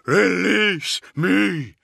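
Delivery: very high, slow, loud creature cry; drawn-out otherworldly roar; thundering monster. A narrator's reading. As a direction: thunder it as a vast demon straining at its chains. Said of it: "the being thundered".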